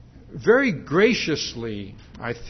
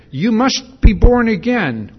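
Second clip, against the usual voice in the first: loud, slow, higher declaration of must be born again; booming command; 8 semitones higher, 9 dB louder than usual. Retold or imitated. Preacher voicing the Lord's blunt command, booming. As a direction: proclaim it like a final divine order. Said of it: "that would be sufficient here".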